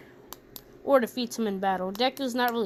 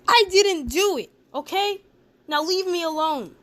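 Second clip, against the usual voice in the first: high-pitched, raised-voice defensive outburst; upset protest; 6 semitones higher, 4 dB louder than usual. Acted. Deku finally talks back to the bullies, acted upset protest. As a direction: protest in a higher voice, acting fed up.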